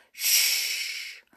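long, voiceless shush; hushing. Acted. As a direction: shush them urgently. Goldilocks hushes the others.

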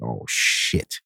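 low, hushed oh shit under the breath; muttered dread. Retold or imitated. Son sees his dad waiting, muttered dread. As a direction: drop it to a quiet, sinking aside.

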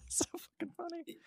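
breathy whisper through laughter; whispered aside. Real, not acted. Whispering an amused aside to co-hosts.

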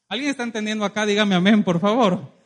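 loud preacher's shout to the congregation; rousing call. Real, not acted. Preacher calls the congregation for an amen, rousing shout. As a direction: build to a loud demand for a response.

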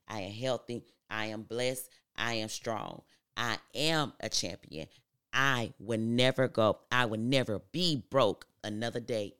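firm, punched declarations in short phrases; emphatic affirmation recital. Acted. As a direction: declare each one with conviction, building in volume.

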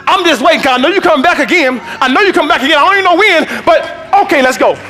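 high, loud, building shouted sermon; preaching at full force. Acted. Preacher to congregation, rising into full shouted preaching. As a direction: lift the church with every line.

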